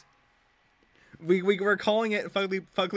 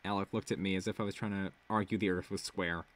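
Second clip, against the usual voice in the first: usual speaking voice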